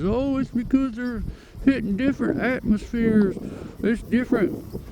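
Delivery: mocking skeptics. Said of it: "Everybody says".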